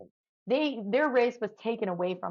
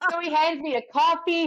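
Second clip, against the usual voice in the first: normal talking voice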